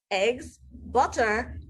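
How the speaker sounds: ordinary delivery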